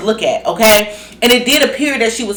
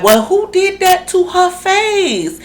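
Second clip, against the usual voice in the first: mock question; 10 semitones higher, slower than usual. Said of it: "[name] asked [name], like".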